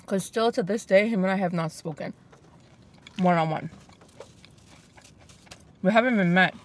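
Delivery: chewing while talking; talking between bites; mouth full. Real, not acted. Seen 4: bites fries and chews with puffed cheeks throughout labelled words.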